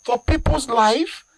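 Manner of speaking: high-pitched, impassioned accusation; indignant challenge. Real, not acted. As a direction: challenge the hospital in outrage. Pressing the outraged accusation further.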